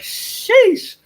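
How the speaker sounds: high drawn-out "sheesh"; high-pitched wowed reaction; amazed exclamation. Retold or imitated. Retold stunned reaction to her words.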